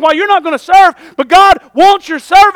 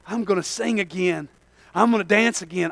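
hushed inner thoughts; 7 semitones lower, 13 dB quieter than usual. Retold or imitated. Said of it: "I wonder what their thoughts were".

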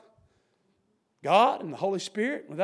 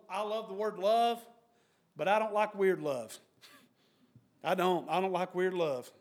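ordinary talk, nothing marked

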